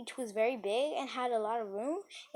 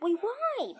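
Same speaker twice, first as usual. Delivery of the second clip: surprised question; 12 semitones higher, 6 dB louder than usual. Retold or imitated. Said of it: "and she's like".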